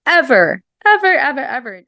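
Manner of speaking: insistent, rising evers; emphatic repetition. Real, not acted. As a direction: stretch out ever, ever, ever, pushing harder each time. Emphatic repeated never ever.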